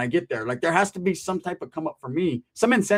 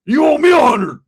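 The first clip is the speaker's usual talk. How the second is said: high, loud outraged shout; mock outraged shout; indignant yell; 11 semitones higher, 11 dB louder than usual. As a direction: yell it like he's the one owed. Retold friend flipping the debt.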